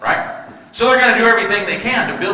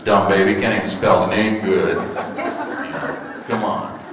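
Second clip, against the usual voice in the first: low scoffing grumble; grumbling men; 10 semitones lower, 4 dB quieter than usual. Retold or imitated. Men dismissing the baby's card, low grumbling scoff. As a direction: scoff at it like a sceptical guy. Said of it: "And men go".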